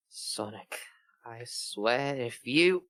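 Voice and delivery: slow, low warning growl; a hint of a menacing Shadow; mock threat. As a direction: slowly warn him off.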